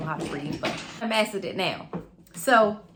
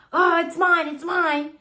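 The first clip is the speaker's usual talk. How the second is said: higher, louder whiny "it's mine"; mock child grabbing toy; 9 semitones higher, 6 dB louder than usual. Mother imitates daughter fighting over a toy at school, higher and louder. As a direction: play a kid tugging back a stolen toy.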